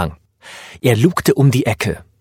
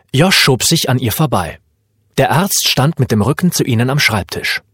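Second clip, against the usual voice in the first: usual speaking voice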